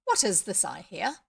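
loud startled cry. Acted. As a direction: call out, startled by the voice. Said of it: "he cried".

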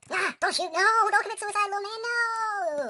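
high, loud, drawn-out wail; melodramatic panic. Pretend. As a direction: wail in hammed-up horror. Mock panic, little man dying.

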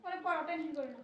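very soft, calming voice; quiet reassurance. Acted. Relative telling someone not to worry, gently reassuring. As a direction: soothe them in a low, soft voice.

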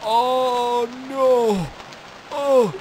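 loud, high, drawn-out wailing; dismayed wail. Real, not acted. Player losing sight of his island, loud high wail. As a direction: wail each word in hammed-up despair.

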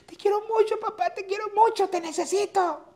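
put-on very high, loud child crying for his father; wailing child. Boy at his father's funeral begging him to stay, high loud wail. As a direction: wail it like a small boy clinging to the hearse. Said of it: "Y el niño".

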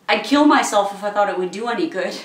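desperate. Acted. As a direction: burst out in desperate regret. Desperate apology to suitor.

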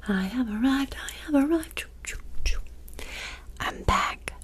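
ordinary delivery

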